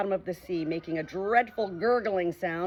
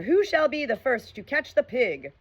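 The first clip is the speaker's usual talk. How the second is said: gleeful pirates; 7 semitones higher, 4 dB louder than usual. A narrator's reading. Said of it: "laughing with glee".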